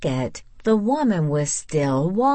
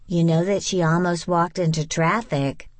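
ordinary delivery, nothing marked

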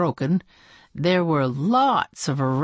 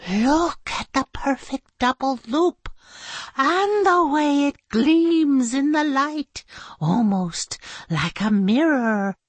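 marveling; 9 semitones higher than usual. Acted.

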